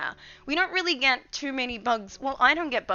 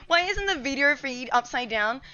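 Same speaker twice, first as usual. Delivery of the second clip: ordinary delivery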